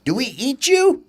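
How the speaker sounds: put-on high eager baby bird; little bird voice. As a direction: ask it like a small curious chick. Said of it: "said Ollie".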